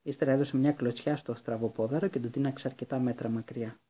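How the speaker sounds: ordinary delivery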